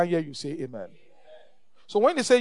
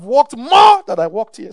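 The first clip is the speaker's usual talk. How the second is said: emphatic shout; 4 semitones higher, 11 dB louder, slower than usual. Real, not acted. Preacher's emphatic testimony.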